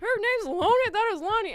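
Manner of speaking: very loud, high-pitched mock disbelief; Sudeikis catching her out. Imitating the sketch teacher calling out her mistake, incredulous yell. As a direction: shout it, scandalised by the wrong name. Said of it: "And he caught me".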